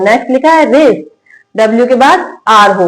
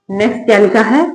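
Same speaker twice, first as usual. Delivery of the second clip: usual speaking voice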